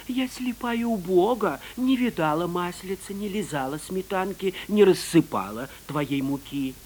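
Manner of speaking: pleading fox voice. Acted. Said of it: "Стала отрекаться Лиса".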